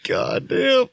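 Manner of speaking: high, teary helpless laughing; crying with laughter. Real, not acted. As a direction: laugh until you cry. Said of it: "get it together".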